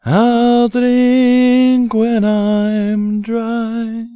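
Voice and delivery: usual speaking voice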